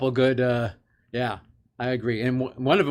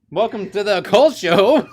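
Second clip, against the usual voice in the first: higher, louder, slow welcome; show intro; 7 semitones higher, 7 dB louder, slower than usual. Acted. Host kicks off the podcast with an announcer welcome. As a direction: announce it like a showman.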